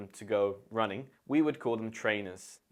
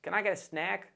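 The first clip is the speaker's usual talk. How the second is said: high-pitched mock Australian accent; 8 semitones higher than usual. British teacher jokily asking for a snag, in a mock Australian accent. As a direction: put on a bad Australian accent. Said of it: "That's my Australian accent".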